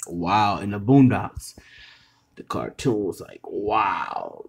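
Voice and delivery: usual speaking voice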